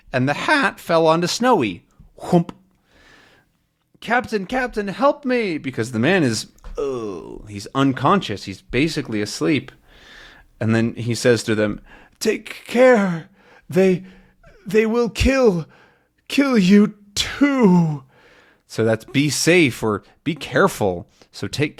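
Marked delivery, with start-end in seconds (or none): 4.0-5.6 high urgent call; Tintin calling the Captain; calling for help. Acted. 12.2-15.7 dying man's warning. Acted. 16.3-18.0 dying warning. Acted. Dying man warning slowly.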